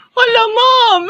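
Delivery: high loud fake crying shout; hammed-up wailing. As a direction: wail like a scolded kid. Punished student wails.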